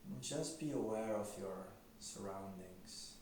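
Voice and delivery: quiet, unhurried meditation voice; guided meditation. Acted. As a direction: gently guide them into stillness, leaving long silences.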